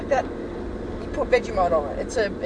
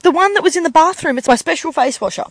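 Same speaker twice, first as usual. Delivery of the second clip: put-on higher, louder kid voice; insistent daughter; 6 semitones higher, 6 dB louder than usual. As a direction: whine it like an exasperated child. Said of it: "She said".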